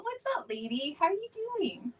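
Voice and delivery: normal talking voice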